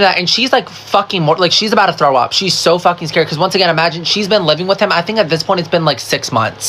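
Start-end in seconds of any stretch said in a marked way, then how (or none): none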